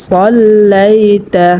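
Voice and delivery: সল্লাইতা chanted slowly with a heavy স; recited Arabic. Shown as a demonstration. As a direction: repeat সল্লাইতা as a slow recitation. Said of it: "এখানে পড়তে হবে". (সল্লাইতা ধীরে তেলাওয়াতের সুরে)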